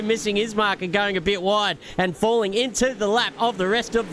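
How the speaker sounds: excited lap-by-lap commentary; race commentary. Acted. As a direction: call the race live.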